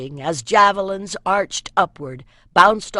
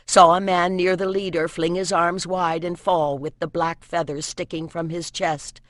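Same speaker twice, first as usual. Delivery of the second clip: ordinary delivery, nothing marked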